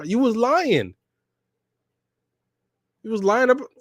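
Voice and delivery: high-pitched, worked-up accusation; indignant outburst. Real, not acted. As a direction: call out the liar, voice shooting way up. Ranting about a villain's lie.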